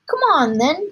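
eager storybook father; excited cry. A narrator's reading. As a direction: call the boy along eagerly. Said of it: "cried Mister Killicranky".

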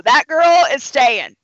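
slow loud nurse's verdict; knowing verdict. Retold or imitated. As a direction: deliver it like a final call.

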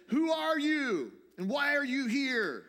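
high, loud sentry's challenge; soldier's sharp shouted demand; challenging soldier. Retold or imitated. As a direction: shout it slowly like an armed guard stopping an intruder. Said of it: "a soldier steps out from the shadows with a gun".

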